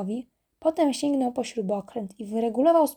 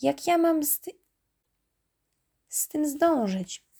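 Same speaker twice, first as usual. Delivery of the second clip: sighing dismay; 5 semitones higher than usual. A narrator's reading. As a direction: sigh it out, pitched up and slowing at the end. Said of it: "westchnął".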